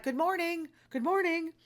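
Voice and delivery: high, sing-song good mornings; cheery greeting. Retold or imitated. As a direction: chirp it like a happy retiree. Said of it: "people are like".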